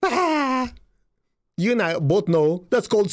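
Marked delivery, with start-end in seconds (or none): 0.0-0.7 high delighted "Ah!"; an aha cry of discovery; realizing cry. Retold or imitated. Healer suddenly understanding the billionaire, delighted cry. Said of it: "I'm going".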